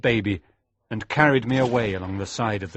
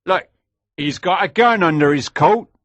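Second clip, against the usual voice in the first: put-on higher, louder old smuggler's call; 9 semitones higher, 8 dB louder than usual. Old smuggler warning the others, lightly voiced. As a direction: point out the gun loudly, a touch of an old man. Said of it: "said an old smuggler".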